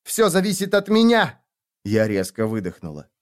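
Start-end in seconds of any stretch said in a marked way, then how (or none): 0.0-1.4 high angry shout; fierce cry, stress on меня. Acted. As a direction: insist at the top of her voice.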